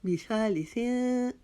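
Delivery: measured recitation, nasal end on बिसालिसिन; nasal ikhfa. Shown as a demonstration. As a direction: chant बिसालिसिन, lingering on the final n. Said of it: "एक अलिफ के जितना".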